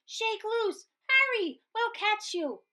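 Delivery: high, urgent shouts of encouragement; shouting. A narrator's reading. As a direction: call up urgently to hurry. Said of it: "the prairie dogs shouted".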